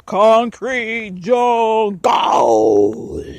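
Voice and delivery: slow, stretched, shouted concrete jungle; drawn-out shout. Real, not acted. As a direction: belt it out like a slogan. Dramatic repeat of phrase.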